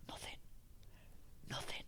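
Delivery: hushed, nearly whispered single words; sudden hush. Retold or imitated. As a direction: drop to a whisper to show silence. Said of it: "like somebody turns your ears off".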